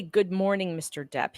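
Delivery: ordinary delivery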